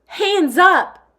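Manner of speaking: put-on high, loud child yelling in play; little girl shouting during a cops and robbers game; kid's shout. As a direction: bark it like a pretend cop. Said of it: "one of the little kids near the fountain shouted".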